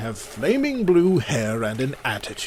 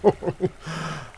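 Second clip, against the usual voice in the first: amused chuckle; 6 dB quieter than usual. Acted. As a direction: laugh quietly, enjoying their fright. Hades amused by mortal fear.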